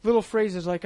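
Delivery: ordinary delivery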